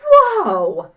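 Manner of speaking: loud, high amazed gasp; whoa. Real, not acted. Teacher amazed at the ant picture, loud whoa. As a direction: wow them with the picture.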